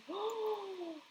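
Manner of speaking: sharp high gasp of dismay; shocked gasp. Real, not acted. High gasp at the character's doctor-ordered caffeine ban. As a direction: gasp as if hit with bad news.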